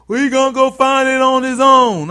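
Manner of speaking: high, loud mock movie line; loud quote. Retelling Dummy's line to the camera, loud and high. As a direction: belt it like the character.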